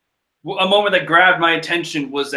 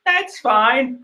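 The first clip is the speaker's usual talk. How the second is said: strained acceptance; 8 semitones higher than usual. Real, not acted. Fan swallowing disappointment, high and restrained. Repeats the denial more softly.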